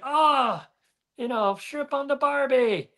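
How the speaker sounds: mock Aussie buddy. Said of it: "And then he's like".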